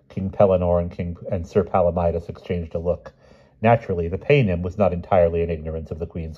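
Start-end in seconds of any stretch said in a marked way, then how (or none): none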